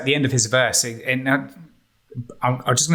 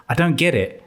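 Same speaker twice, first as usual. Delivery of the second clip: ordinary delivery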